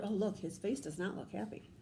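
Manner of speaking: ordinary talk, nothing marked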